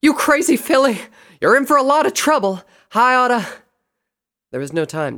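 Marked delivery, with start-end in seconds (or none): 0.0-3.6 high, loud, scolding yell; emotional yelling. A narrator's reading. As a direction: scold a found friend at full voice. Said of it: "She choked before yelling again".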